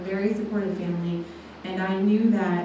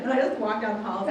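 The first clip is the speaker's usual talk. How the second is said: ordinary delivery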